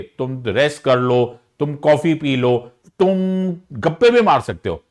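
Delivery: usual speaking voice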